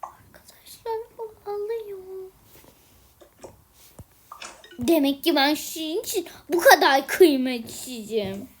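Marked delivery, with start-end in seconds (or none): 0.0-2.3 fast, hushed whisper to the viewers; whispering while hiding. Acted. Saklanacağım. (saklanırken fısıldayarak)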